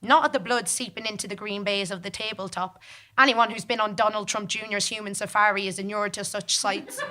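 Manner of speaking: plain reading voice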